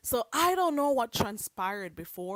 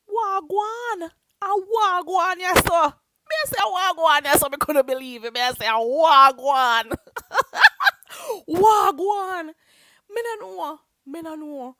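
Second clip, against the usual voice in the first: higher, louder patois greeting with laughs; playful Jamaican patois; 7 semitones higher, 11 dB louder than usual. Pretend. Greeting live-chat viewers in playful Jamaican patois. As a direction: toss out wagwan in mock Jamaican.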